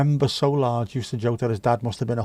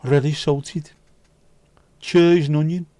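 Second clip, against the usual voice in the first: Brummie accent; 3 semitones higher, 4 dB louder than usual. Shown as a demonstration. Streamer continuing the Brummie crisps demo, Birmingham accent. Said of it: "all crisps sound better in a Brummie accent".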